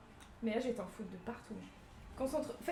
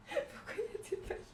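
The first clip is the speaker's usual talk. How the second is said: laughing; 11 semitones higher, 3 dB quieter than usual. Real, not acted. Laughing at glitter surprise.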